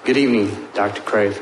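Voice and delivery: usual speaking voice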